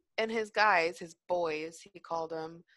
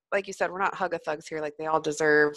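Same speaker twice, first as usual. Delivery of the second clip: normal talking voice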